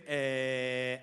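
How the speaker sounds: low drawn filler eh; hesitant teacher's eh. Retold or imitated. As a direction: play the teacher searching for a word. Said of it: "my English teacher used to say".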